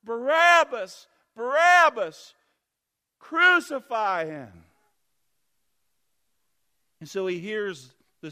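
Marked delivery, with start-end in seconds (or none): 0.0-4.7 loud, high, slow chant; crowd yelling. Acted. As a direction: shout it as the roaring mob. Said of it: "They're yelling".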